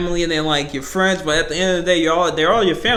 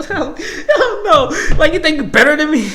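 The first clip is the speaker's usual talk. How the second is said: laughing mockery; 11 semitones higher, 5 dB louder than usual. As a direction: laugh it up high while mocking him. Mocking the racist dad.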